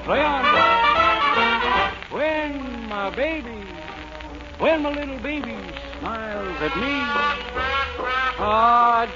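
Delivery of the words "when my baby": ordinary talk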